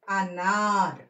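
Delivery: అనార్ with long aa and a bare final r; slowly modelled అనార్, middle vowel stretched; half final letter. Shown as a demonstration. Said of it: "half అక్షరంగా పలకాలి".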